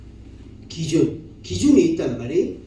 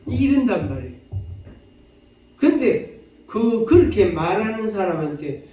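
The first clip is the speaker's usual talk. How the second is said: ordinary talk, nothing marked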